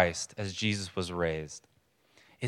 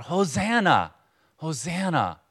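high-pitched acclaim, only mildly raised; crying out; 6 semitones higher, slower than usual. Retold or imitated. Preacher voicing the crowds' earlier welcome, crying out. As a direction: lightly imitate a crowd calling in praise. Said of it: "a few days earlier crying out".

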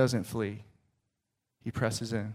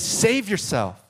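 higher, slow taunt; mocking shout; 8 semitones higher, 3 dB louder, slower than usual. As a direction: draw the taunt out slowly. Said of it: "the people shouted".

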